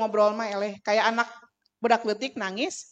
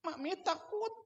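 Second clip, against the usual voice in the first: soft, high little voice; mock-frightened murmur; acted timid plea; 7 semitones higher, 9 dB quieter than usual. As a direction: say it small and high, playfully fearful. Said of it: "abi teh kieu weh".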